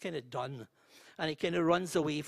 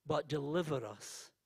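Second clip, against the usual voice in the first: prayer. Real, not acted. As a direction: lead the room in prayer. Leads the Lord's Prayer.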